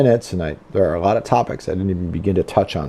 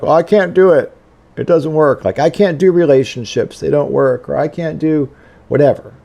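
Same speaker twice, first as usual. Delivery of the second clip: shame-based self-talk; 6 semitones higher, 4 dB louder than usual. Retold or imitated. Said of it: "be shame-based and be like".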